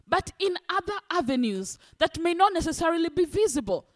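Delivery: usual speaking voice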